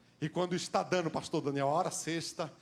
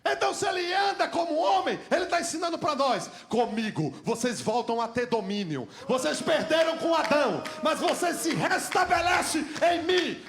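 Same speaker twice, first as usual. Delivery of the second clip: loud, high shouted preaching; crying out the sermon in rising fervor; fiery preaching; 7 semitones higher, 6 dB louder than usual. Acted. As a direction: preach it out with full force. Preaching at full force.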